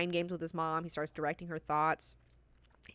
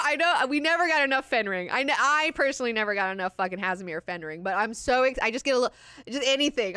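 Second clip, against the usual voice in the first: gushing fan delight, pitched up; real, breathless fangirl excitement; really excited; 4 semitones higher, 6 dB louder than usual. Real, not acted. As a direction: burst out with a loud, high "oh" and gush. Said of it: "I was really excited about that".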